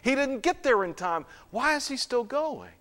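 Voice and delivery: a baffled crowd's protest; questioning onlookers. Acted. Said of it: "Others said".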